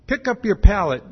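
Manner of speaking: loud commanding call; Jesus commanding the lame man, loud command. Retold or imitated. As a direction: order him to pick it up, projected. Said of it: "he commands this guy".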